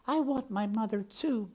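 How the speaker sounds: high princess voice, not hushed; tender princess. A narrator's reading. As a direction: share her longing, pitched high. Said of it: "said the princess softly".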